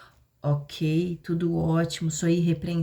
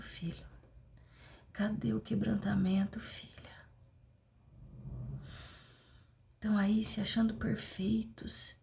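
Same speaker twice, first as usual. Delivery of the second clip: softer, breathy sermon whisper; hushed preaching; 5 dB quieter than usual. Acted. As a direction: draw the congregation in with a near whisper.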